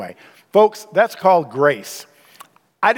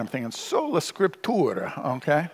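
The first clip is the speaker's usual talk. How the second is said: put-on quiet Italian accent; 8 dB quieter than usual. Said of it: "heavy Italian accent".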